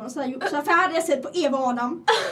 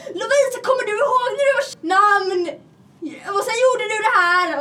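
high, loud mocking sing-song; teasing classmate; 8 semitones higher, 7 dB louder than usual. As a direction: tease her with a bratty nagging voice. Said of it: "alltid en tjej i klassen som alltid skulle bara". (retande klasskompis, hög och högljudd)

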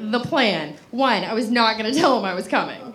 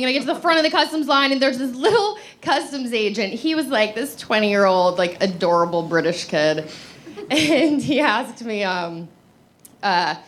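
ordinary talk

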